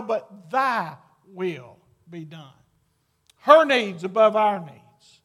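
full preaching. Acted. Pastor urging husbands toward sacrificial love, loud and emphatic. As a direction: drive each phrase out to the congregation.

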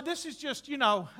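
normal talking voice

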